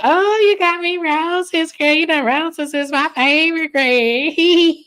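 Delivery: high hammed-up delighted girlfriend; mock girlfriend voice. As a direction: gush over the roses in a hammed-up high voice. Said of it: "She got".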